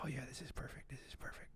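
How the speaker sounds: barely audible producer's whisper; hushed, conspiratorial whisper; secretive whisper. Retold or imitated. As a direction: whisper it into a colleague's ear. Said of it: "leans into somebody else and says".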